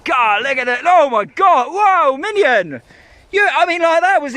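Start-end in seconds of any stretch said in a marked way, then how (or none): none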